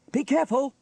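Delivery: high, loud warning call. A narrator's reading. James warns Sarah in the tree, calling. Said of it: "called James to Sarah".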